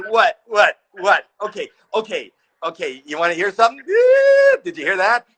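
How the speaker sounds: jittery, rising and loud, nervous stage panic; nervous rambling. Retold or imitated. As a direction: blurt it out, flustered. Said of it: "laughing at my nervous energy".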